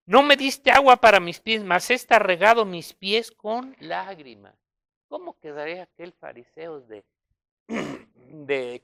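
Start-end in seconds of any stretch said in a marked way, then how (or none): 0.1-4.5 loud Jesus quote. Acted. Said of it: "La mujer dice".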